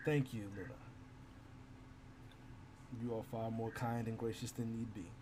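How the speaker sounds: low, soft, holding back tears; grateful soldier, quiet and grave; moved warrior. Acted. Hunter thanking Luna for her kindness, softly moved.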